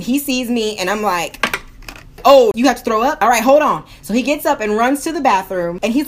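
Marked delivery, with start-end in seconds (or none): 2.3-3.8 loud, urgent reaction; alarmed bystander. Retold or imitated. Bystander sees someone about to throw up, alarmed. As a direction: blurt it out, louder than usual.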